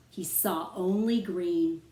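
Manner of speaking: ordinary delivery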